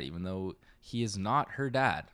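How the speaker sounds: usual speaking voice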